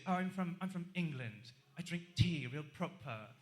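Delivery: a light, hammed-up English accent, proper and polite; lightly mock a polite English bar patron in an English accent. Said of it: "they sound a little bit like this".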